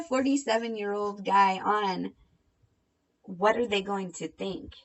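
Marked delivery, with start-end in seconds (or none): none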